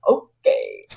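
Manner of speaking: breathy, deadpan mock okay; imitating dad's bored sarcastic okay. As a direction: toss off a dry, sarcastic okay. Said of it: "he'll just sarcastically be like".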